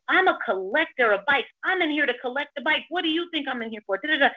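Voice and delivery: high, insistent mimicry of a stranger; mock-affronted self-justifying rant; retold indignation. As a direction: imitate a huffy man insisting loftily. Acting out the indignant man.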